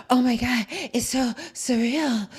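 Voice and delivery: exaggerated breathy gasps between phrases; hammed-up winded awe, dragging at the end; mimicked breathless storyteller. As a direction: imitate her, gulping air as you go. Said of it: "talking like this because of the story".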